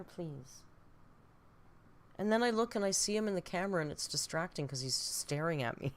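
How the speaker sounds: ordinary delivery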